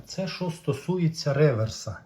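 ordinary talk, nothing marked

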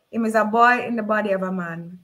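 usual speaking voice

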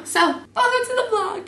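high bright welcome; sing-song intro voice; high vlog greeting. Real, not acted.

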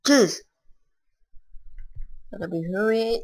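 ordinary talk

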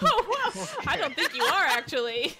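loud, high burst of laughter; laughing. Real, not acted. Friend amused by a failed accent, laughing loudly. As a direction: laugh loudly through the words.

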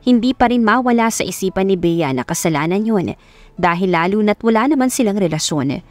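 plain reading voice

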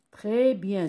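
ordinary talk

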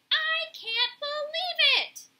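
very high, loud, drawn-out exclamation; amazed pigeon. A narrator's reading. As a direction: squawk it in astonished delight. Pigeon amazed at meeting Roz.

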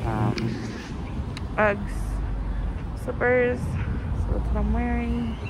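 muffled behind face covering; speaking through cloth mask. Real, not acted. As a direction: mouth covered by fabric. Seen 1: Patterned cloth mask covers mouth and nose throughout; no mask in R frames.

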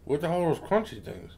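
talking between bites; chewing while talking. Real, not acted. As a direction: mouth partly full. Seen 0: Left man's hand is at his mouth, jaw chewing through the words.

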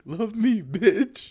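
very high-pitched teasing squeal; hammed-up high imitation of being too direct; mock blunt demand. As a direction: squeal it as a joking demand for love. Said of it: "more just direct".

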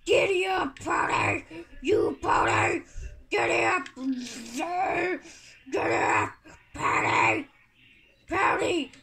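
loud, high hollering at a mount; panicked play shouting; frantic shouting. Pretend. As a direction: yell urgently to spur on a pony. Urging pony out of lava.

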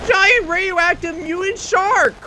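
high, loud alarmed cry; loud panicked cry at the shark; hammed-up alarm. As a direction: shout it like a B-movie victim. Sees shark in game.

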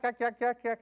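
ordinary delivery

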